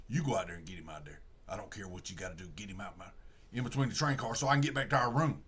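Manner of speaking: voiced killer husband barking orders low; low gruff order. Killer tells wife to lure the man, low gruff. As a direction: growl it like a hard man ordering his wife.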